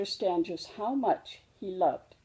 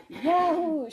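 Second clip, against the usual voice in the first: high, bright shout of delight; joyful cry; 10 semitones higher, 4 dB louder than usual. Acted. Cub wakes on Christmas morning, crying out with delight. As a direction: burst out with Christmas-morning joy. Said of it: "she cried".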